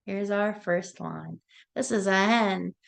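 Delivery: ordinary delivery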